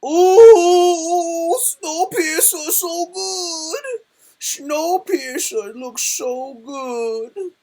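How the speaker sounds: very high, drawn-out mock squeal of delight; exaggerated high-pitched swooning; hammed-up excitement. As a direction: gush in a slow, high, over-the-top wail. Gushing over the trailer just watched.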